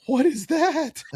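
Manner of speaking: high-pitched imitation of gawking outsiders; exaggerated high-voiced bafflement; mock astonishment. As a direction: squeal it in comic disbelief, way up high. Out-of-staters shocked by Florida sights.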